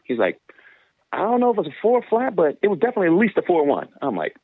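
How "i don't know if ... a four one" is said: high coach voice conceding; coach confirming. Retold or imitated. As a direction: mimic the coach half-agreeing. Another coach confirms time.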